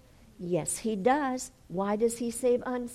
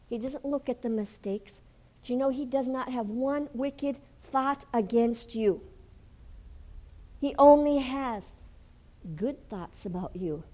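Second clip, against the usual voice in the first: ordinary talk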